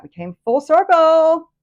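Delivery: real excitement. Real, not acted. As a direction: burst out thrilled, stretching it. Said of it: "So excited".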